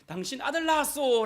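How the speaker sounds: high, loud announcing cry; messenger's joyful call. Retold or imitated. Messenger announcing a son to the father, high and loud. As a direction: call out the good news. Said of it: "라고 말하는 그 말을".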